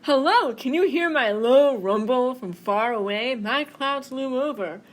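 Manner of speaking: the storm calling from far away; loud, looming thunder. Acted. Thunder voiced.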